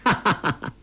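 high staccato "ha-ha" burst; acted delighted chuckle; quick playful villain laugh; villain's laugh. A narrator's reading. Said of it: "欧阳克笑道".

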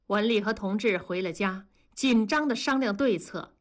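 plain reading voice

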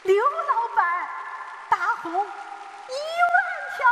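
loud, long-held excited call; excited, trembling shout. A narrator's reading. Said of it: "激动的，声音都有些颤抖的喊道".

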